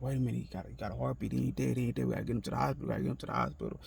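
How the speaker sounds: retold urgency. Telling her he has a heartbeat, restrained retold urgency. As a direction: replay sudden hope without raising the volume.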